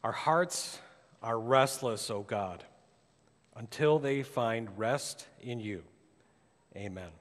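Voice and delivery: hushed, reverent prayer voice. Acted. Opening prayer before sermon.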